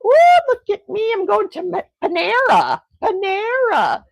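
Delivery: high mock bragging, loud at the start; hammed-up show-off crowing; imagined friend showing off. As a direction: play a pretend big spender, high and teasing. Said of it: "You would be like".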